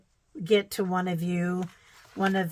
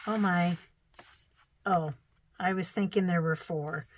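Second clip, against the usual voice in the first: normal talking voice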